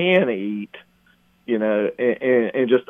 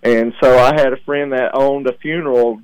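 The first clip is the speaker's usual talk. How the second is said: ordinary talk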